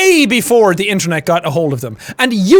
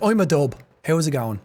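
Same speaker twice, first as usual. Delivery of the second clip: lower, mimicked Dublin accent; 5 semitones lower, 5 dB quieter than usual. Streamer showing how Dubliners talk, Dublin accent. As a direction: put on a low-key Dublin accent. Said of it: "the accent is from Dublin".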